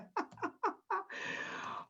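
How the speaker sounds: unvoiced airy laugh; breathy laugh. Real, not acted. Host teasing a shy attendee, airy laugh. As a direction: giggle on air only.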